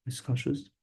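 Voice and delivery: usual speaking voice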